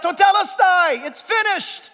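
loud, high, drawn-out proclamation; high ringing shout; crying out. Retold or imitated. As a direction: cry it out from the pulpit in triumph. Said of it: "cried out".

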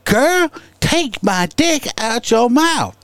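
high slow mocking impression; a hammed-up high voice. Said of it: "And I said".

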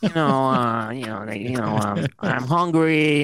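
slow, grumpy cigar man; lightly voiced cranky interviewee; Steve Sokka impression. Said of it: "That's a really good Sokka impression".

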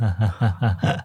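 low laugh. Real, not acted. Reacting to the idea.

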